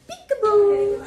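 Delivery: high, loud parrot squawk. Retold or imitated.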